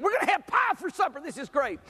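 put-on a small boy bursting with excitement over pie; excited little boy. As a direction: gush like a kid promised pie. Youngest son's excited quote.

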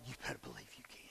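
soft whisper; whispered assurance. Acted. Pastor whispering assurance to a seeker. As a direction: hush it with certainty.

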